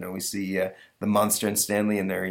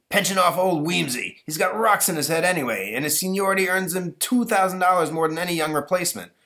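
higher, forceful angry-dad voice; pushy, raised-voice caller; blustering Bilge Barker; 10 semitones higher, 3 dB louder than usual. Acted. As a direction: pitch your scheme like a pushy father.

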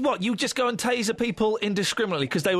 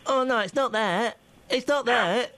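lightly voiced imitation of a colleague; a hint of the co-host's voice; imitating co-host; 2 semitones higher, slower than usual. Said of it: "Is that my voice?".

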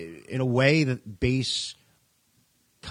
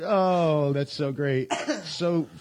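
usual speaking voice

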